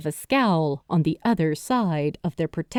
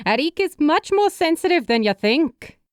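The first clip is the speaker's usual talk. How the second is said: motherly woman voice; 8 semitones higher than usual. A narrator's reading. Character voice.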